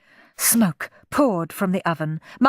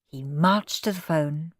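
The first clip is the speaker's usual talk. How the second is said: plain reading voice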